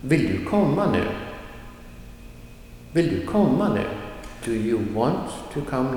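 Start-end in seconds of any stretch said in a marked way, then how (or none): 0.0-1.0 short "vill du", emphasized "komma", short "nu"; stress on komma. Shown as a demonstration. Teacher giving a second example, stressing main verb "komma". 2.9-3.8 short "vill du", emphasized "komma", short "nu"; stress on komma. Shown as a demonstration. Teacher giving a second example, stressing main verb "komma".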